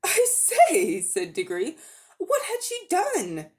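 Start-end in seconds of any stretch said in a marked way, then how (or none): 0.0-1.0 put-on high, loud boyish exclamation; a boy blurting in surprise; startled boy. A narrator's reading. 2.2-3.5 put-on higher, slow, worried boy's voice; a boy asking anxiously, pitched up; young boy Diggory. A narrator's reading.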